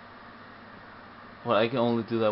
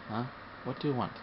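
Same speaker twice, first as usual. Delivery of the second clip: normal talking voice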